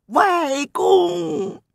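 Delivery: a high grieving call; mournful cry. Acted. The prince kneels before the king and cries out in grief, high. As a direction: wail it out like a wronged child. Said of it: "悲叫了一声".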